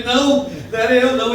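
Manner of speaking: high, loud protest; insistent denial. Acted. Peter denying he knew him, loudly protesting. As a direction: deny it hotly.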